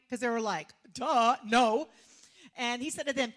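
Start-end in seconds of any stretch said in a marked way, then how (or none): 0.9-1.9 loud, scornful retort; emphatic, exasperated answer; exclaimed duh. Retold or imitated. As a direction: blurt it as if obvious. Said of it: "it has an exclamation point".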